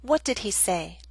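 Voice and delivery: normal talking voice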